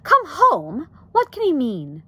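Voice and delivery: high, loud, mildly sharp questioning; sharp exclamation. A narrator's reading. Wife baffled by uncle's message, sharp exclamation. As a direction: raise the voice in puzzlement. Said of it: "exclaimed Homley sharply".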